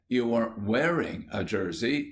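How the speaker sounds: ordinary delivery, nothing marked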